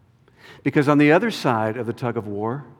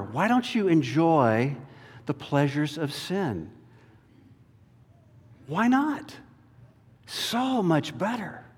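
soft, coaxing, softer tempter; tempting voice; 5 dB quieter than usual. Acted. Temptation luring Moses toward sin, soft and coaxing. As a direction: lure toward pleasure. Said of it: "the temptation facing Moses".

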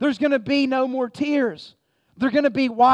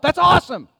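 higher, louder joyful burst; excited exclamation; 5 semitones higher, 6 dB louder than usual. Real, not acted. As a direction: exclaim it with delight. Preacher exclaims about redemption.

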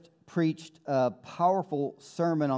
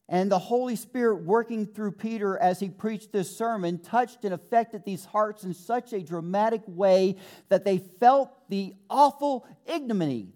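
ordinary talk

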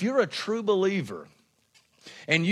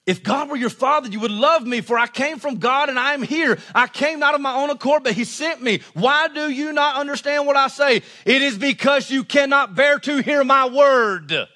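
raised, ringing scripture reading; forceful preaching; 6 semitones higher, 5 dB louder than usual. Real, not acted. Preacher reading Jesus's challenge to the congregation, forceful preaching. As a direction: drive each verse home to the congregation.

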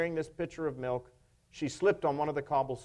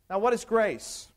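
usual speaking voice

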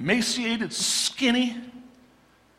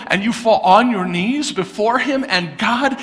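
ordinary talk, nothing marked

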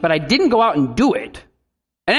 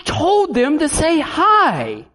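higher, slow exasperated protest; exasperated parent; 8 semitones higher, much slower than usual. Retold or imitated. Embarrassed parent to the guest, higher and slow. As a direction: plead it, baffled and slow. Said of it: "And you're like".

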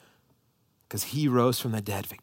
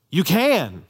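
"you can" louder with a firm fall on "can"; confident declaration; 4 semitones higher, 6 dB louder than usual. Shown as a demonstration. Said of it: "and you hear the difference".

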